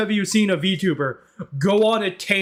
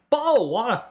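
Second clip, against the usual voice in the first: higher British "Bottle of water" with the t in "Bottle" dropped; exaggerated British attempt; 5 semitones higher than usual. Shown as a demonstration. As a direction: swallow the t of "Bottle", British style. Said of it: "It came out totally wrong".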